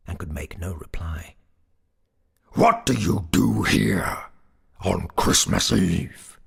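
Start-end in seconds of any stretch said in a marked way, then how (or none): 2.5-6.4 loud, slow, stern goblin voice. A narrator's reading. Goblin questions the sexton in the churchyard, sternly. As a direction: demand it sternly, drawing the words out. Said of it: "said the goblin sternly".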